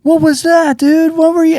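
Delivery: very high, incredulous questioning; mock bewilderment. Friends question the comic after his set, high bewilderment. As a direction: squeal the questions in disbelief.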